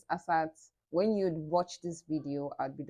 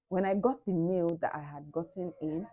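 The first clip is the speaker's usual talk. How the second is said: normal talking voice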